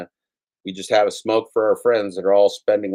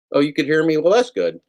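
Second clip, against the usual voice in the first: higher, louder sudden relief; relieved surprise; 8 semitones higher, 5 dB louder than usual. Real, not acted. Streamer learning chat heard him, relieved. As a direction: perk up in relief. Relieved the audience can hear.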